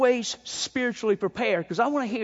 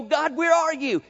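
desperate plea; 7 semitones higher than usual. Retold or imitated. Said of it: "and you go".

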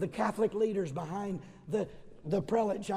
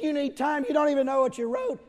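louder and higher, fast and scornful; mocking; 7 semitones higher, 8 dB louder than usual. Prelates mocking Luther for needing time, loud and fast. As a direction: scoff at him. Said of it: "They laughed at Luther".

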